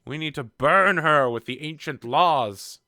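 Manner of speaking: shouting down. Acted. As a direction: shout down a heretic as outraged clergy. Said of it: "trying to shout down Siasi".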